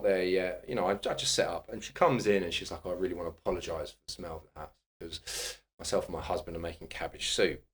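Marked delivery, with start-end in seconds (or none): 2.8-7.6 hushed, rushed apology to the therapist; a client quietly apologising for the smell of the house, hushed and quick; soft quick apology. Retold or imitated. Client apologising, quoted softly.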